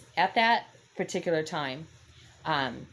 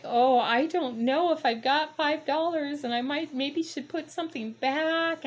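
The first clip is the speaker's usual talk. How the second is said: put-on high, slow, fretting old lady voice; old lady unsure of her money; worried old lady; 11 semitones higher than usual. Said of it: "the lady's like".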